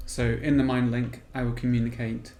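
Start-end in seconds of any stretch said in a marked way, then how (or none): none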